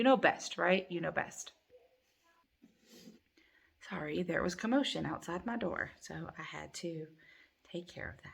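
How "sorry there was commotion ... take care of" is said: real hushed whisper. Real, not acted. To viewers after a commotion outside her door, whispered. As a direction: whisper the apology so no one hears.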